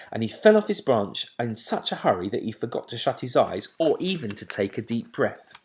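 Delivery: usual speaking voice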